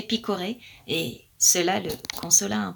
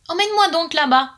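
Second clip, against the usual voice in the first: quick, high, eager bird voice; eager plea; 6 semitones higher, 5 dB louder, faster than usual. Acted. Blackbird pleads with the magpie to take him, fast and eager. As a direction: rush the words out, raised and keen. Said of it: "dit le merle".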